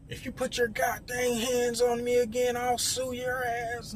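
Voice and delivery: high, slow, angry threat; old man's threat. Retold or imitated. Older man threatening the security guard, mock angry. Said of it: "him telling the security guard".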